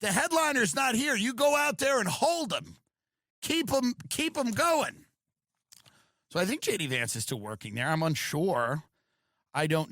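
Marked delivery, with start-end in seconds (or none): 0.0-5.0 high, loud club manager voice; a comedy booker sending out the opener; comedy club talk. Retold or imitated. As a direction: bark orders like a club manager. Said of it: "as we'd say".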